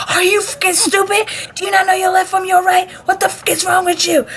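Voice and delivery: very high-pitched mock tirade; imitated popping off. Instructor scolds recruit for bag on wrong side, imitated popping off. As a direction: berate as an outraged instructor, exaggerated. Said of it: "just popping off at any little thing".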